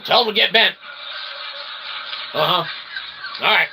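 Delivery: usual speaking voice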